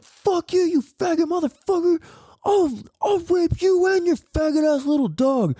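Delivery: high-pitched imitated tirade; hammed-up high, strained threat; retold angry outburst. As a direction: explode like someone who has had enough. Fed-up friend's retold outburst.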